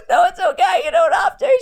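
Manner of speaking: high, loud tearful protest half laughing; crying through words. Retold or imitated. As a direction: sob out that it's fine. Crying, telling mum not to come.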